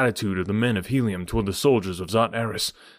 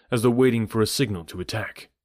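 ordinary delivery

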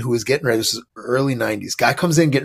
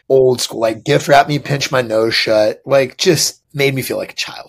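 usual speaking voice

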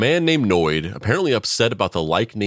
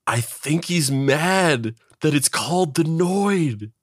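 higher, slow dawning disbelief; incredulous realization; 7 semitones higher, slower than usual. Pretend. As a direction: spell out the dawning realization, slowly. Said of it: "be like".

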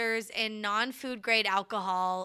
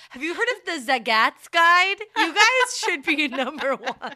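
gushing diner; 6 semitones higher, 7 dB louder than usual. Acted. Voicing the delighted diner.

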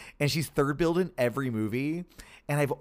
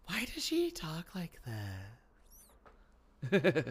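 Liv Tyler voice; 2 semitones higher, 5 dB quieter than usual. Retold or imitated. Mocking how Liv Tyler talks, airy and soft. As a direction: float the question up softly like Liv Tyler. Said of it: "She talks like that".

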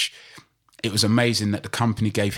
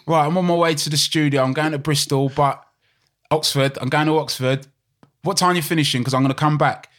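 retelling a friend's call in a Cockney voice, higher and louder; mock Cockney voice; 6 semitones higher, 3 dB louder than usual. Friend on the phone promising to come back, mock Cockney. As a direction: play the caller lightly, Cockney swagger. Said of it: "really deep and Cockney voice".

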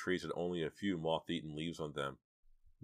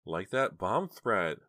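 plain reading voice

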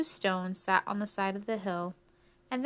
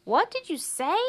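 high, loud called-out question; cricket who can't hear; 8 semitones higher, 7 dB louder than usual. Acted. Cricket shouts to the mouse over music, oblivious.

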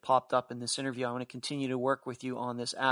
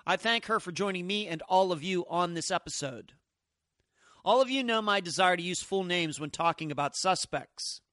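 podcast narration; 4 semitones higher than usual. Acted. Host reads scripted podcast narration.

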